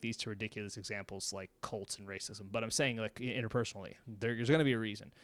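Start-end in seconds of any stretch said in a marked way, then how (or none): none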